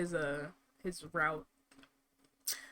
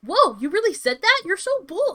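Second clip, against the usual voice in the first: hammed-up anime heroine gasp; overacted anime dub; 6 semitones higher, 7 dB louder than usual. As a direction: gush like a dub voice actor. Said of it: "I sound like an anime character".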